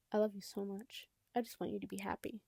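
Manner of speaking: soft, hushed whisper; quiet whisper. A narrator's reading. Ma to her crying son, tender whisper. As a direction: breathe it gently, barely voiced. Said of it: "she says quietly".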